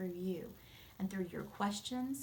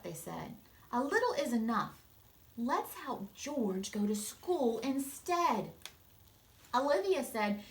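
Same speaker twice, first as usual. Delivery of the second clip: put-on lively read-aloud voice for kids; storybook narration; 3 dB louder than usual. A narrator's reading. Teacher reading a picture book to her students, expressive narration. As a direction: read it like story time for a class.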